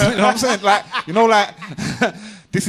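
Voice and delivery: amused, laughing aside. Real, not acted. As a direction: break into giggles mid-sentence. Laughs mid-point.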